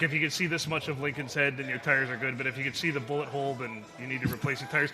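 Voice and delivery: ordinary delivery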